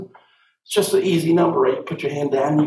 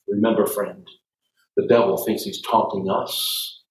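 ordinary delivery

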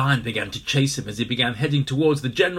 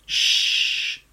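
shushing; 5 dB louder than usual. A narrator's reading. Gorillas together shushing the noise. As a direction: hush them all. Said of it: "they all said together".

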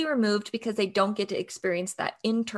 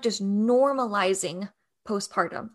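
ordinary delivery

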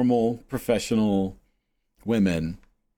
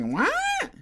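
very high mock-clueless squeak; spacey old lady. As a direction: imitate a baffled, spaced-out student. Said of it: "they're just so spacey".

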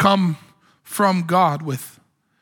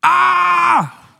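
high, loud shout of terror; terrified cry; 11 semitones higher, 5 dB louder than usual. Retold or imitated. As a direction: scream as if you just saw God. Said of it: "this is New King James for something like".

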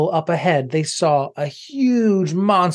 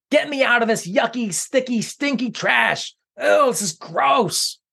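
disgusted villain; 9 semitones higher than usual. Acted. Villain buried in trash.

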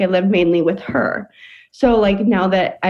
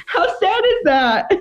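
laughing through it; 9 semitones higher than usual. Real, not acted. Laughing while telling sad story.

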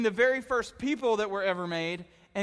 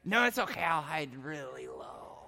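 mock little brother; 6 semitones lower, 5 dB quieter than usual. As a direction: play the brother calmly ruining it. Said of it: "brother answers".

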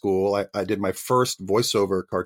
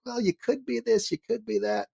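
high, quiet, airy imitation of a casting agent; a sing-song mock of Hollywood flattery, high and soft; 13 semitones higher, 6 dB quieter than usual. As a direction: parody the breezy casting pitch, pitched way up. Mimicking casting people's empty promises.

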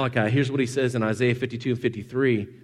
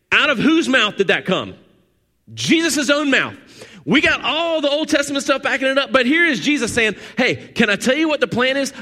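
raised, high, loud pulpit preaching; preaching at full force; 8 semitones higher, 4 dB louder than usual. Acted. Pastor driving the point home to his congregation, preaching at full force. Preaching peak about the cross.

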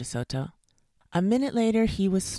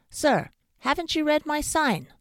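high, loud indignant call; shouted; 11 semitones higher, 6 dB louder than usual. A narrator's reading. Dentist to fox at the door, high shout. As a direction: shout it down to someone outside. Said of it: "Doctor DeSoto shouted".

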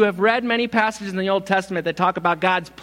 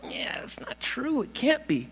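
doubting inner voice; 8 semitones higher, 8 dB quieter than usual. Retold or imitated. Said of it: "things in the back of your head saying".

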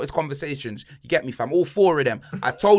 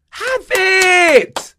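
"Have it" screamed very high and very loud; full yell; 11 dB louder than usual. Real, not acted. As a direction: scream it, totally fed up. Giving away the Champions League spot.

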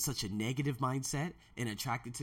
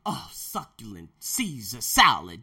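savoring; 4 semitones lower, 8 dB louder, slower than usual. Real, not acted. Savoring the salad.

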